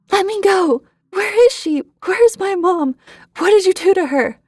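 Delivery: high, loud, furious yell; furious screaming. A narrator's reading. Boy fighting off strangers yells high and loud in fury. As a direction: scream at the captors in rage and fear. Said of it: "I was scared, but I was furious".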